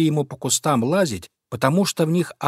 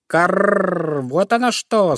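higher croaking caw; crow caw; 6 semitones higher, much slower than usual. Acted. Young crow caws at the fox, higher.

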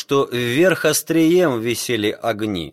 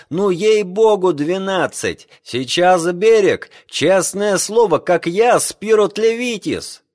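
high, loud eager swearing; excited shouting; 8 semitones higher, 4 dB louder than usual. Acted. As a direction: insist at the top of voice. Greek sailor excitedly swears.

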